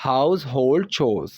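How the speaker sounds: "chores" said slowly as "chos", no r sound; slow model pronunciation. Shown as a demonstration. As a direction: spell out "household chores" slowly, dropping the r. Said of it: "pronunciation सीखना आप ध्यान से". ("chores" धीरे से, बिना र की आवाज़ के)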